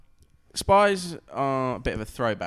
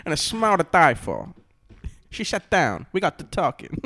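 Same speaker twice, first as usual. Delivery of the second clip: higher, hammed-up noir storytelling; playful noir romance narration, pitched up; mock noir narrator; 5 semitones higher, 4 dB louder than usual. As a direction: recall a femme fatale in melodramatic voice-over. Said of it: "A noir thing".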